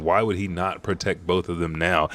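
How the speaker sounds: usual speaking voice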